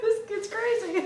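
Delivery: high-pitched aside through laughter; imagined mom. Pretend. Listener playing the mom watching her yelling kid, high aside. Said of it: "my mom is just like".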